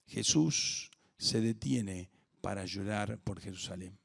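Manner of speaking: ordinary talk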